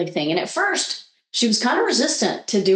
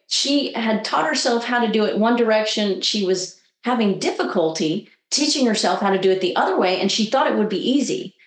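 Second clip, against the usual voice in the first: ordinary talk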